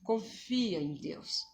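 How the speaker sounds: very soft, hushed and slowing reassurance; tender calm murmur to a frightened child; gentle divine counsel. Retold or imitated. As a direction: soothe like a loving voice from above. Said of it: "se for assim".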